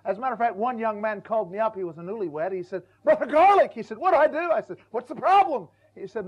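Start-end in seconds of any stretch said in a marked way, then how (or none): 3.0-3.7 put-on higher, louder anxious young husband; a panicked young newlywed pleading, higher and louder; imitated young man. Young man's call voiced. 4.0-4.5 put-on higher, louder anxious young husband; a panicked young newlywed pleading, higher and louder; imitated young man. Young man's plea voiced. 4.9-5.7 higher and louder, a mock-concerned question; a hint of mock pastoral concern; pastor replying.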